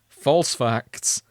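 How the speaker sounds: ordinary talk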